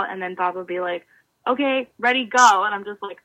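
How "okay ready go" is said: louder call, punching the last word; coach's send-off. Retold or imitated. Coach starting the swimmer's next repeat, brisk call.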